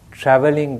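normal talking voice

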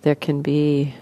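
soft, even voice with long pauses; guided meditation. Acted. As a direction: gently guide listeners into relaxing, leaving space between phrases.

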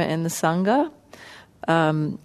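ordinary delivery

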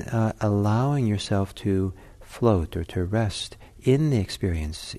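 usual speaking voice

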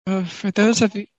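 rough, grating voice. Real, not acted. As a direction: talk through a scratchy, grating throat. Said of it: "sorry for the annoying voice".

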